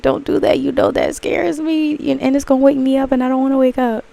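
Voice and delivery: high, pleading, half-joking fear; mock scared plea. Telling the dream not to wake her, high mock-scared plea.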